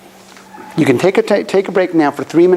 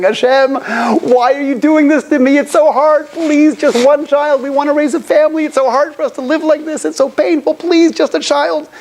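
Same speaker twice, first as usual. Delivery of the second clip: imitating a desperate plea; 9 semitones higher than usual. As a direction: beg God as if in tears. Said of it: "crying and screaming".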